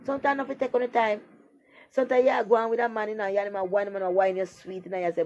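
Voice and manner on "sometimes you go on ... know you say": ordinary talk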